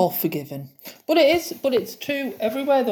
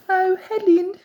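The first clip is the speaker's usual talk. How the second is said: higher sing-song Midlands voice; Midlands hospital woman; 9 semitones higher, 4 dB louder than usual. Retold or imitated. Hospital patient calling Helen in a Midlands accent. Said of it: "their accent's a bit different".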